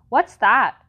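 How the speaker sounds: put-on loud blunt demand, a kid's yell. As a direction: shout it out, confused. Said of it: "they would yell".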